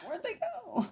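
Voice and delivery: sudden high-pitched puzzlement; squeaky surprised question; surprised high squeak. Real, not acted. Searching for dropped scissors.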